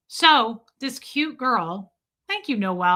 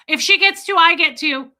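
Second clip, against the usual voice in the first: put-on high, loud childish insistence; eager; 6 semitones higher, 6 dB louder than usual. Asking the breeder about dog photos, mock eager. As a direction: demand it like a jealous kid. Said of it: "And I was like".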